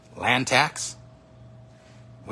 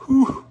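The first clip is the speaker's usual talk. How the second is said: excited whistle-like whew; 9 dB louder than usual. Real, not acted. Excited pull.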